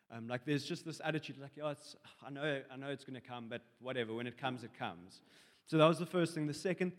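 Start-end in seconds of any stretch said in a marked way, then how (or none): 1.6-5.2 soft, offhand mock shrug; nonchalant. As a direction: toss it off quietly, voice sinking at the end. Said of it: "the whole nonchalant attitude".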